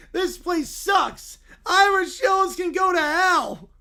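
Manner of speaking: put-on whiny angry little boy, shouting in bursts; furious child voice yelling at the town; Caillou tantrum. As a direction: throw a bratty tantrum as a spoiled kid. Reading AI Caillou tantrum story.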